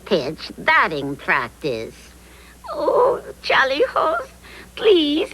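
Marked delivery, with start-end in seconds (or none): 2.7-5.3 high, tearful begging; pleading. Acted. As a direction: beg him to help. Pleading with the captain.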